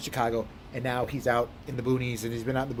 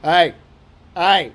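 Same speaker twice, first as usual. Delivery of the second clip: very loud, higher mimicked greeting; brother's greeting; 5 semitones higher, 12 dB louder than usual. Brother starting every phone call, bellowed greeting. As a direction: blast it out, imitating a country trucker. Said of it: "he always starts like".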